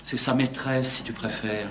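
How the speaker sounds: low-pitched, subdued explanation; confidential aside. Acted. Révèle le secret à mi-voix. (confidence à voix basse)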